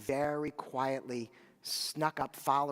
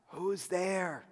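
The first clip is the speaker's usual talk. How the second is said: lightly voiced sick old woman calling through the door; a hint of a frail old widow asking who knocks; 4 semitones higher than usual. A narrator's reading. Old widow to the knocking rabbi, a lightly voiced sick call. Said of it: "a very old, sick voice called out".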